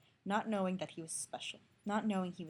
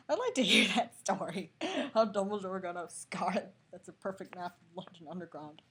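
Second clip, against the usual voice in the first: laughing; 6 dB louder than usual. Real, not acted. Laughing aside about scar.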